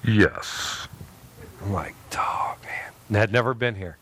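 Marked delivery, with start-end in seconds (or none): 0.1-0.9 a hint of a lower God voice. God picks Albany. 2.2-2.9 stunned whisper; whispered oh man. Retold or imitated. Pastor stunned by God's answer.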